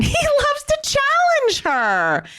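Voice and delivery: high-pitched, drawn-out mock defence; exaggerated high, slow impression; Leanne impression. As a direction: jump way up high and draw it out, imitating her.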